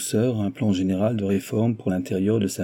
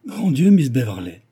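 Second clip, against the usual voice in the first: higher, louder alarmed exclamation; a read-aloud gasp of dismay; terrified cry; 4 semitones higher, 6 dB louder than usual. A narrator's reading. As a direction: cry out in sudden fright. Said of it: "s'écria madame Harel épouvantée".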